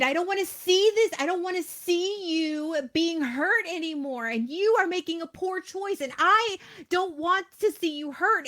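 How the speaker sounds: exasperated plea to wake up; frustrated scolding. Retold or imitated. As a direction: scold them out of love, voice up high. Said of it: "I'm like".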